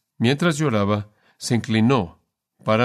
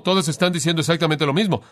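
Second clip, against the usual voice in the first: normal talking voice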